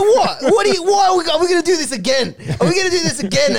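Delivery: high, loud, sputtering protest; exasperated outburst. Acted. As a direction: stammer the questions in mock outrage. Acted exasperated quarrel in retold script.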